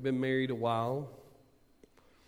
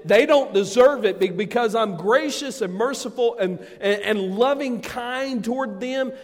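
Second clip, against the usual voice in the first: loud, high, driving sermon voice; full-force preaching; 10 semitones higher, 12 dB louder than usual. Acted. Pastor rousing the congregation, loud full-force preaching. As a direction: preach it hard to the whole room.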